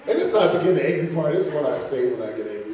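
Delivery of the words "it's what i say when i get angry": normal talking voice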